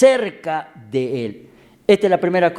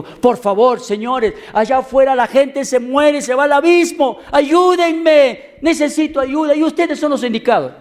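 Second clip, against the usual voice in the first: desperate plea; 8 semitones higher than usual. Acted. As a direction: beg the congregation for help. Young man begs church for help. (súplica desesperada, aguda y fuerte)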